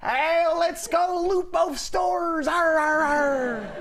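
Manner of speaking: hammed-up pirate. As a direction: roar it like a looting pirate. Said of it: "A pirate would say".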